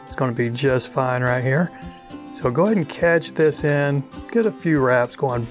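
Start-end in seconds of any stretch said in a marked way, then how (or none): none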